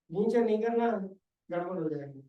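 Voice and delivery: ordinary delivery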